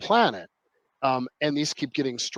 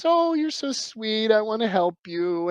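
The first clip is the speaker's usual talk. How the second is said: mock sweet angel; 6 semitones higher than usual. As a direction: coo it like a false friend luring someone in. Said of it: "the angel buddies up with you and goes".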